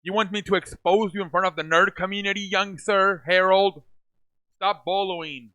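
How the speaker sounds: mock scolding. Mock scolding Harold on stream.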